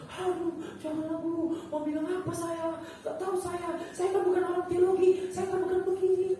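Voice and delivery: whiny "oh no, not me"; reluctant protest. Retold or imitated. Acting out own reluctant protest.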